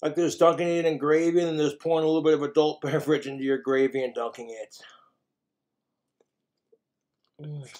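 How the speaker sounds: eating between words; talking while chewing. Real, not acted. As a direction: mouth partly full of food. Seen 1: holds chicken at mouth, bites before; lips and cheeks show chewing.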